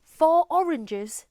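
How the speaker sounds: Geordie "Four oranges" with no linking R; Geordie accent. Shown as a demonstration. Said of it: "let's hear how [name] says it".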